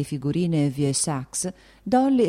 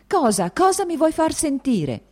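furious cry, much higher and louder; 12 semitones higher, 5 dB louder than usual. A narrator's reading. Kitty lashes out at her sister in fury, shouting high. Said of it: "diceva con furia".

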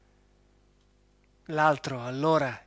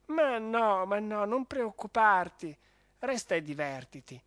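dazed baron; 6 semitones higher than usual. Acted. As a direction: thank them weakly, still groggy. Baron reassures Fulvia.

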